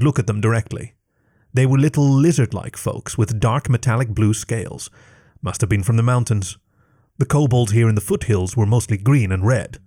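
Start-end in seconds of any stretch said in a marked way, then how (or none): none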